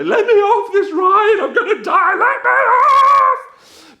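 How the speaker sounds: high, very loud hammed-up terror, last cry stretched out; acted panicked scream. Rider begging operators to stop, panicked scream. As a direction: scream to be let out, comically desperate. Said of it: "start shaking a bit louder".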